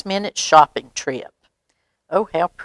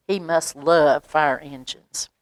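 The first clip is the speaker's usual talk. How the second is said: ordinary delivery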